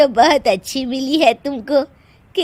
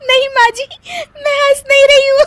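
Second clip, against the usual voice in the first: high, louder denial bursting with laughter; laughing denial; 13 semitones higher, 6 dB louder than usual. Acted. Daughter-in-law denying she laughs to mother-in-law, giggling. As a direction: deny laughing while laughing. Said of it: "फिर से हंस रही हो".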